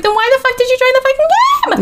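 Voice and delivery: high, incredulous outburst; exasperated yell. Retold or imitated. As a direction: shout it in disbelief. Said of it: "And I was like".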